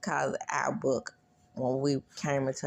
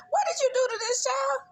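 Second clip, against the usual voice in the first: very high mock accusation; shrill outraged squeal. Teachers confront the man.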